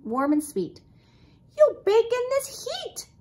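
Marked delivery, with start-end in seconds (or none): 1.6-3.1 high, loud scolding aunt; high-pitched exclamation of warning; crying out. A narrator's reading. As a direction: cry it out in a high, raised voice. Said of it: "Aunt Sassy cried".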